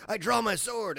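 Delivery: high, theatrical declamation; mock Shakespearean. Retelling a modernized Romeo and Juliet, mock Shakespearean. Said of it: "spoke in Shakespearean talk".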